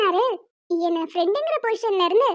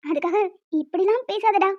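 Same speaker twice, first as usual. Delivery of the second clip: ordinary talk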